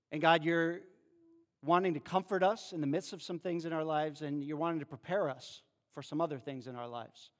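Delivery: hushed, earnest prayer voice; quiet pastoral prayer. Acted. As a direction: pray softly to God. Opening prayer.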